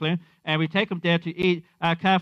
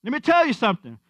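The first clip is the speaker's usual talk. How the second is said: higher, punchy mimicry of a YouTube comedian; comedian catchphrase; 6 semitones higher than usual. Friend at Chick-fil-A mimicking a comedian, higher catchphrase voice. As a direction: toss out the catchphrase like a stand-up bit. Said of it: "He was mimicking the guy".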